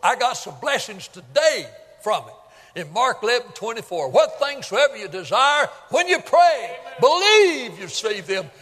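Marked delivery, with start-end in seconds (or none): 4.1-8.5 preaching at full force, rising to a shout; shouted scripture. Acted. Preacher to congregation, shouting the verse. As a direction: hammer the verse home to the congregation.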